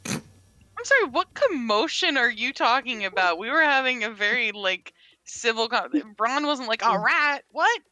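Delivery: mock innocence. Jane plays dumb to Ran about the commotion, high mock innocence. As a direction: play dumb, all wide-eyed.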